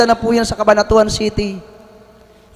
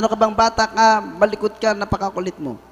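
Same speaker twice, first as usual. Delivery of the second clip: mild scolding; 5 semitones lower, 8 dB quieter than usual. Retold or imitated. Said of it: "Sabi sa akin".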